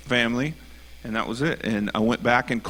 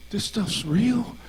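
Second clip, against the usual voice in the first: much higher, slow, wonderstruck; awed; 10 semitones higher, much slower than usual. Retold or imitated. Retold fan realizing it's real, slow high awe. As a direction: say it slowly in awe. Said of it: "he goes".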